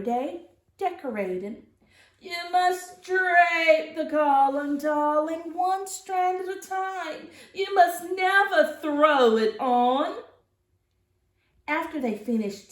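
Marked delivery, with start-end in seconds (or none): 2.2-10.2 high, loud, singsong grande dame; louder, higher sing-song grand old aunt. Acted. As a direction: sing out instructions like a theatrical aunt. Auntie Claus instructing decorating.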